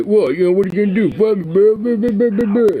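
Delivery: high, loud sneering yokel whine; mocking whiny yokel. Mocking gun-ho yokels who whine, in a high squeal. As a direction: squeal it like a blustering coward.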